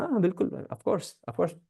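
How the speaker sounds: lightly voiced husband, softly and hastily agreeing; Shiva's placating assent. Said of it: "तो शिव भगवान कहते हैं". (शिव बनकर धीमे से हामी भरना)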